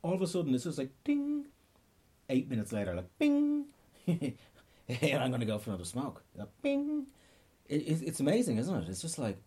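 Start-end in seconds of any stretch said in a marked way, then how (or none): 1.0-1.5 a sudden high-pitched ding like an alarm. Retold or imitated. Comic telling how cravings pop up, high ding. 3.2-3.7 a high, ringing bing; ping it like a notification. Retold or imitated. Comedian mimicking the craving alarm, high bing. 6.4-7.1 a high, ringing bing noise; ping it like a notification. Retold or imitated. Comedian mimicking the craving alarm, high bing.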